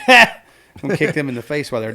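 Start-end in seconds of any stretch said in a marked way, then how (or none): 0.0-0.4 loud, high, single "Ha!"; sudden gleeful bark of a laugh; one-shot taunting cackle; mocking laugh. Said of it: "Whereas Harley would be like".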